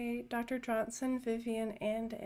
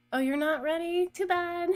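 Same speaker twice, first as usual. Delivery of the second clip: teasing; 6 semitones higher, 7 dB louder than usual. Pretend. Teasing viewers about the basement.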